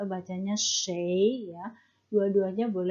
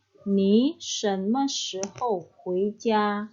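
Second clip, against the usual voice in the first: slow model sentence; much slower than usual. Shown as a demonstration. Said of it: "Contoh kalimatnya".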